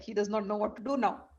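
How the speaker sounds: ordinary delivery, nothing marked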